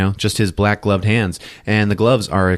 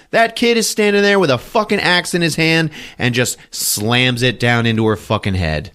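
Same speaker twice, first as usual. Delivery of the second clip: ordinary delivery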